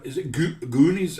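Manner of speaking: a questioning go at Scottish; Scottish accent attempt. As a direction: try the word out in a Scottish accent. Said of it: "trying to do it in a Scottish accent".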